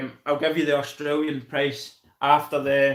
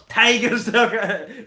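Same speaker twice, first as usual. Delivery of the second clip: laughing; 6 semitones higher, slower than usual. Real, not acted. Laughing at his own name mix-up.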